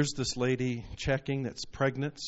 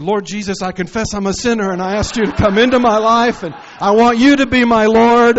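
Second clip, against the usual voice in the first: shouted prayer of confession, much higher and louder; loud retold prayer; 8 semitones higher, 14 dB louder than usual. New believer finally praying to Jesus, loud fervent cry. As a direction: cry it out to God with full force. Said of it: "I said".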